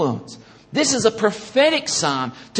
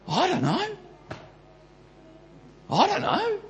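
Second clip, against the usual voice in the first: higher, whiny I don't know; mock clueless shrug; 13 semitones higher, 5 dB quieter than usual. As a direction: play the lost, clueless person. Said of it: "most people go".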